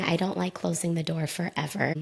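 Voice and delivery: normal talking voice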